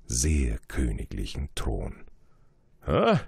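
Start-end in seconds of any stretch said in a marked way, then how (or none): 2.9-3.2 high, loud pleased royal cry; a king delighted to spot a subject; king calling out. Acted. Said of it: "rief der König".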